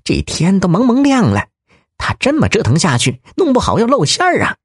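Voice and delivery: high and rushing, panic rising; a thin-voiced crook cracking under pressure; losing his nerve. Thin-voiced bandit fretting they'll be caught, high and fast. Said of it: "尖细嗓子挺不住了".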